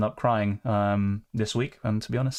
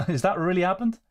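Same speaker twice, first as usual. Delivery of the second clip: higher, laughing in real disbelief; stunned, laughing question; 7 semitones higher than usual. Real, not acted. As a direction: laugh it out, can't believe it happened. Transfer glitch at the deadline.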